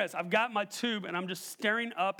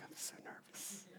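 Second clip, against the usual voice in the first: tiny, barely voiced confession; nervous whisper; 21 dB quieter than usual. Nervous dad admitting it to his companion, whispering. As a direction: whisper it very softly. Confessing nerves before tubing.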